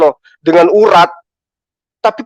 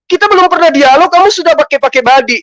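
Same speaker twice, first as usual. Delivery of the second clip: heated; 7 semitones higher, faster than usual. Real, not acted. Heated rant at rival Bom.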